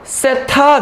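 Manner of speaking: 세타 with a firm t and drawn-out accent; stressed 세타. Shown as a demonstration. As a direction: deliver 세타 as the emphatic correct reading. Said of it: "세차가 아니라".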